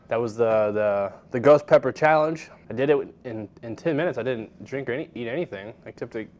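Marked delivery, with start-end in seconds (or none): none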